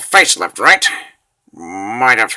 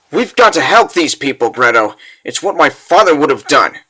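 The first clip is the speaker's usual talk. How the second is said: ordinary delivery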